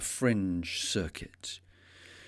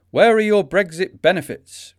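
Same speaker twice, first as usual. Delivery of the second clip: raised, louder heckler's cry; imitated shout; 8 semitones higher, 8 dB louder than usual. Protester heckling a room full of Brexit fundamentalist Tories, imitated shout. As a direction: call it out like a protester at the front. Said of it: "uncovered his slogan T-shirt and shouted".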